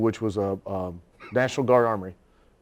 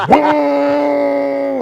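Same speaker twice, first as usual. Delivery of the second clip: put-on high, loud, held-out kid's whoa; little kid startling the biker, high and drawn out; 8 dB louder than usual. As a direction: stretch out a long excited whoa. Kid imitated in story.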